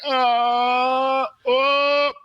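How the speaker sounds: long, high imitated groan; mock groan. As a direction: moan like you're lying hurt, hammed up. Retelling groaning on the ground.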